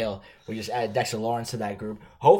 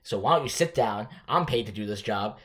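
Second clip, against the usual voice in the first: ordinary talk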